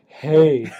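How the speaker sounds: drawn-out, playful "hey"; mock conversation opener, stretched out; Mike's trade-talk greeting. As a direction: tease a friend with a long, loaded hey. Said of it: "that's how Mike starts all of his trade talks".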